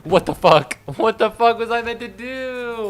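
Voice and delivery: loud, high-pitched indignation; exasperated high yelp; baffled outburst. Real, not acted. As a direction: cry out in bewilderment at the game. Outburst after dying in the game.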